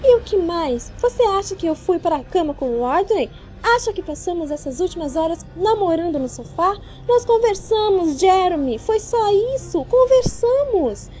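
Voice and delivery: muito aguda e alta, palavras lentas no fim; defesa furiosa. A narrator's reading. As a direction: grite que só conversaram. Noiva furiosa se defende. (fúria aguda e alta)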